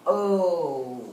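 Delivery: drawn-out "O" on its own; stretched first syllable. Shown as a demonstration. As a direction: hold the "O" of "oatmeal" long and low. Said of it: "I'm gonna say the first part of that word".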